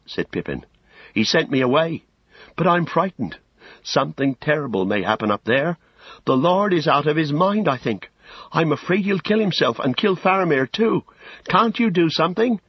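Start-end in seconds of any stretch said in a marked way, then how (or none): 1.1-12.6 high, anxious pleading; frightened halfling. Acted. Pippin fears for Faramir and begs Gandalf, frightened.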